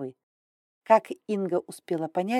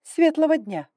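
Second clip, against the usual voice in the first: higher, loud stately greeting; haughty dragon lady; 10 semitones higher, 6 dB louder than usual. Acted. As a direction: greet with cool dignity. Grai greets, voiced high.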